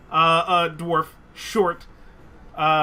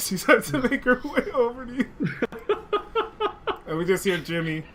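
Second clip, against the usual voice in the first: ordinary talk, nothing marked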